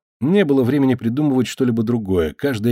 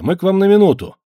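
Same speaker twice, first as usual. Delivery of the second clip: loud, higher call through the door; 9 semitones higher, 6 dB louder than usual. A narrator's reading. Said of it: "громко сказал Костенко".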